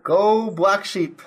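slow, drawn-out cheer, high and loud. Real, not acted. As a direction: rally cry for the team, stretched out. Cheering the guest's project.

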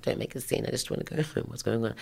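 hushed, whispered plea; embarrassed. Retold or imitated. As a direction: whisper so nobody else notices, shrinking away. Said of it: "being a little bit embarrassed".

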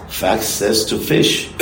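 ordinary talk

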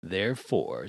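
usual speaking voice

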